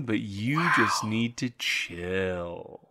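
slow, soft stoner voice; lazy, spaced-out stoner; stoner drawl. Pretend. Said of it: "stoner brother".

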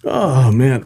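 normal talking voice

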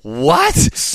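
high-pitched, disbelieving yelp; incredulous. Retold or imitated. Host reacting to friend's untold story, incredulous.

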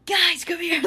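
high, loud call for help; acted-out shout from the stairs; yell downstairs. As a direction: call urgently to people downstairs. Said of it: "yelled downstairs".

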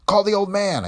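high, loud urgent yell; officer yelling to wake the captain. Acted. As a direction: shout the order in sudden alarm. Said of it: "I yelled".

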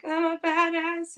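high, slow, unsure self-affirmation; timid. Retold or imitated. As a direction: say it like you don't believe it. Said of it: "a little timid".

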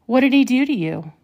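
usual speaking voice

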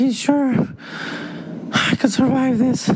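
very high, thin martyr voice; squeaky self-pity. Retold or imitated. Hungover dad tells the kids he'll survive, very high and self-pitying. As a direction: play the suffering martyr.

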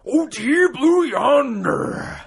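high, drawn-out gravelly growl; hammed-up Macho Man Randy Savage voice; Macho Man impression. Shown as a demonstration. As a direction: stretch it out like a wrestling promo. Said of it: "Let's see who's got the best accent".

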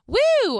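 loud, high whoop to shake off emotion; high whooped "woo". Real, not acted. Host resetting after getting emotional, a loud high woo. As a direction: let out a bright, relieved whoop. Shaking off tears before moving on.

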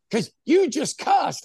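high, slow, prim accusation; scolding lady. Retold or imitated. Imitating the lady who accuses him of cussing, high, slow and prim. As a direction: mimic a prissy scold.

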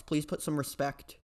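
usual speaking voice

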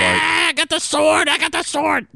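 high, hammed-up hero voice; excited hero voice. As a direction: gloat over the sword like a hero. Hero voice, much higher.

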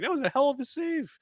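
high, lightly voiced opponent praising the save; impressed opponent. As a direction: toss off a high, friendly compliment. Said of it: "And he'll be like".

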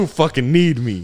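acted villain taunt, slow; Joker. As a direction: play the villain taunting the hero slowly. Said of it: "I'm the Joker".

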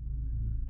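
nervous throat clearing. A narrator's reading. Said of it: "Bill cleared his throat".

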